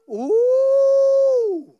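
high, loud ecstatic ooh; excited whoop. Real, not acted. Preacher exclaims in excitement.

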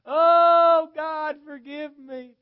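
huge high-pitched holler, words stretched out in anguish; hollered wailing. Retold or imitated. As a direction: imitate a woman hollering in torment at full volume. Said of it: "She'd holler out".

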